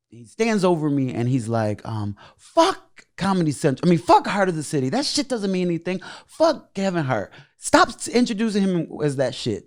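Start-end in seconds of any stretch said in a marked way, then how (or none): 2.4-9.7 higher-pitched mock tirade; imitating someone scornfully tearing it down; hostile outburst. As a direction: retell a dismissive, swearing rant in a raised pitch. Hostile stranger's outburst, acted.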